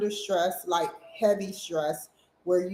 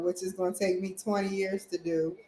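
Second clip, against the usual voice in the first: ordinary talk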